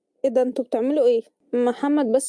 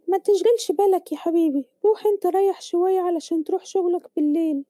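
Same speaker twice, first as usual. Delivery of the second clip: higher-pitched motherly voice; troubled mother reassuring; 6 semitones higher than usual. Acted. Mother sending her son off to rest, high reassuring voice. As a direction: brush off your son's worry in a high mother's voice.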